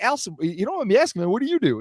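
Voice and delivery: high-pitched, quick retold question; curious asking. Asking a friend's wife about her job, curious. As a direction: re-enact a tentative, curious question.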